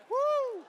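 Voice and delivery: high, loud "woo"; excited whoop. Real, not acted. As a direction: let out a real celebratory whoop. Celebrates after the volunteer's reading.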